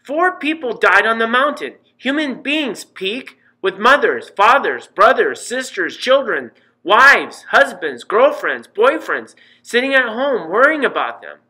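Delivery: a mother building a case, rising; heated mother lecture. A narrator's reading. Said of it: "Mom was just getting warmed up".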